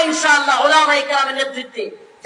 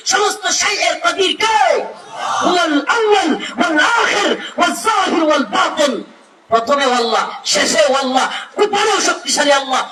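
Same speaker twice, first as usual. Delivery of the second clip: preaching at full force; 6 semitones higher than usual. Acted. Preacher to the waz crowd on God's power, preaching at full force. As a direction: proclaim God's might to the crowd like a revival preacher. Waz rises into Quranic declamation.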